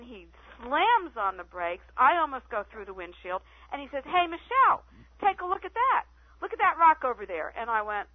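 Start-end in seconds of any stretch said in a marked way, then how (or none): 4.0-7.5 high, louder retold call pointing at something; mock excited call. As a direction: imitate the chief eagerly pointing out the rock. Retold chief calling out to her.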